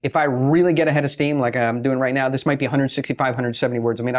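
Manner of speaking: ordinary talk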